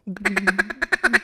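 feeding chuckle call, mimicked by voice; duck chuckle call. Shown as a demonstration. Preacher demonstrating a duck chuckle to the congregation. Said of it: "It's like".

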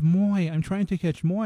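put-on high, slow childish voice; a small girl insisting, slowly; little girl. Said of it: "And she says".

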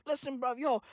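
ordinary delivery

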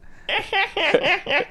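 high squeaky dolphin chatter; dolphin clicks. Pretend. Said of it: "That's beautiful".

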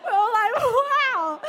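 high, loud laughing wow; shrieking, laughing amazement; amazed. Retold or imitated. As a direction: burst out in astonished laughter. Stunned by name guess.

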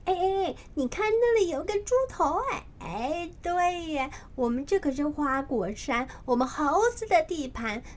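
high, quick chattering monkey voice; a small monkey squeaking the news, high and fast; excited little monkey. A narrator's reading. Little monkey excitedly telling friends about the pig head.

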